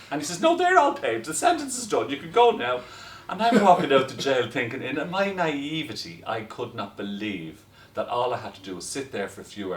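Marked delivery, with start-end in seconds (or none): 0.4-2.8 high, loud quote of the governor; excited governor. Retold or imitated. Governor telling him he can go home, high and loud. 3.3-6.2 amused. Real, not acted. Laughing after release.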